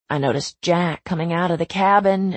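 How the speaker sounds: usual speaking voice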